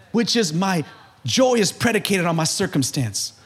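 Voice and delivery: ordinary talk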